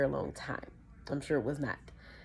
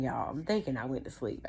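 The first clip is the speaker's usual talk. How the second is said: plain reading voice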